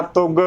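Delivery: ਤੁਗ as "tug", no nasal; nasal dropped. Shown as a demonstration. Teacher showing a wrong reading, mispronunciation. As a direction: leave the n sound out of ਤੁਗ. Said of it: "ਗਲਤ ਉਚਾਰਨ ਹੋਵੇਗਾ".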